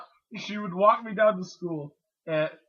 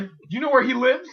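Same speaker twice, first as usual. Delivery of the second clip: mock-indignant mom; 5 semitones higher, 9 dB louder than usual. Acted mom demanding the biter's address.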